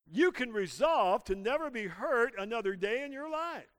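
usual speaking voice

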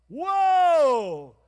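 loud, stretched astonished whoa; amazed whoa. Retold or imitated. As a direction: gasp it out, wide-eyed. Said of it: "and I was like".

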